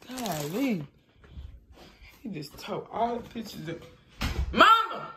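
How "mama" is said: raised, higher call for mom. Real, not acted. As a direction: call to your mother in another room. Calling mother in the house.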